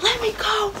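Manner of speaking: high, strained cry of let go; mock a frantic plea, pitched high; imitated desperate cry. Held by the deputy, she cries out high to be let go.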